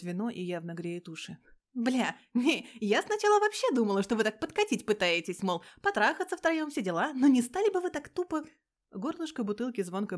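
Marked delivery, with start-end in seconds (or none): 1.7-8.4 loud blunt outburst. Acted. Heroine blurts out her guess.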